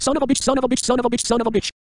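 high fast furious ranting; tantrum. Acted. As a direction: throw a screaming tantrum. Continued tantrum back at Sam.

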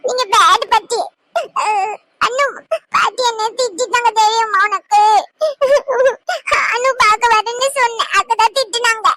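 put-on hurt child voice. Grandson complains to Anu about grandma scolding him, sulky child voice. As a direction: tell on grandma like a small boy.

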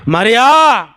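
high, ringing call of the name; loud calling out. Acted. Jesus calls Mary with one word, calling out loudly. ఒకే ఒక పిలుపు.

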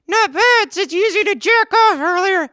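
high squeaky hand puppet voice; ventriloquist dummy voice. Acted. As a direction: pitch way up for the dummy.

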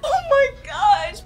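high laughing cry. Real, not acted.

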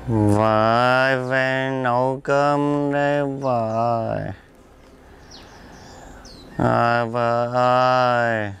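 put-on slow, dragged-out drunken call to his wife; a drunk husband drawling outside the door for his wife. (gọi vợ kiểu say rượu, kéo dài chậm)

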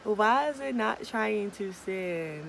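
loud frustrated complaint, slowing down. Real, not acted. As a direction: complain loudly at the screen. Email fails to send.

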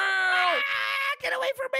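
loud high shriek of panic; high squealing, play-frightened yell; screaming like a little girl. Pretend. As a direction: shriek it in mock terror. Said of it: "He's screaming like a little girl!".

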